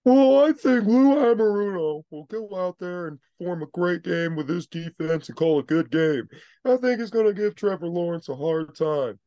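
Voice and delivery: high, loud sarcastic fake praise; mock sincere voice. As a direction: pretend to believe it, grinning. Mocking fake optimism for Bengals.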